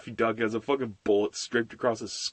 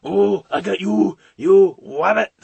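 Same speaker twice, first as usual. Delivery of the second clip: loud, excited cartoon impression; cartoon rabbit hunter; 8 semitones higher, 10 dB louder, slower than usual. Shown as a demonstration. Hunter catches the rabbit, excited cartoon voice. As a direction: crow over the catch in a silly voice. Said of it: "Looney Tunes characters".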